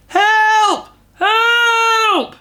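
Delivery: very loud call. Acted. Bear stuck in the bath yells for anyone, loud. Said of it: "and then very loudly".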